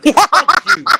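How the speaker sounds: high, loud laughing yell; laughing shout. Retold or imitated. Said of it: "I'm like".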